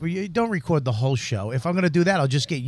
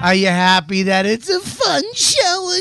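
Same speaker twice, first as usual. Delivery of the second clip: mock whining; 3 dB louder, slower than usual. Host mocking complaining fan.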